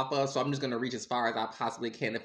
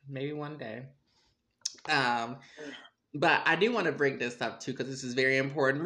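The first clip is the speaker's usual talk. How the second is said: normal talking voice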